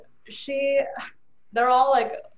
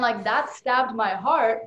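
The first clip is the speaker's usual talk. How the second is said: normal talking voice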